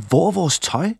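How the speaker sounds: lys, bekymret stemme; de savner tøjet; forvirret spørgsmål. A narrator's reading. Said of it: "spurgte de tre venner". (let bekymrede drenge)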